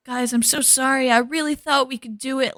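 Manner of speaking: hammed-up heartfelt sorry; mock dramatic speech. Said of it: "giving this whole dramatic speech".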